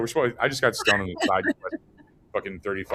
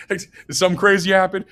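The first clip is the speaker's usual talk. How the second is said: high, louder, laughing mock sympathy; imitating over-the-top concern for a girl; 9 semitones higher, 6 dB louder than usual. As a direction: gush over her like a worried friend.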